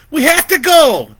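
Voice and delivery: high, loud panicked squeal. Acted. Vicky squeals at Lori to run from the killer. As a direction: squeal it in terror. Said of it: "she squealed".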